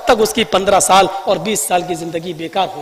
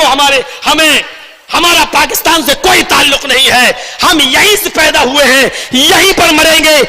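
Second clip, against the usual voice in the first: impassioned rally speech with ringing emphasis; fiery oratory; 5 semitones higher, 4 dB louder than usual. Real, not acted. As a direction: address the crowd like a fiery orator. Impassioned political speech to a crowd.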